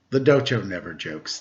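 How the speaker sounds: a hint of a low, soft old cat master; calm, understated old cat samurai; lightly voiced old cat. A narrator's reading. Old cat introducing himself to the Daimyo, low and soft.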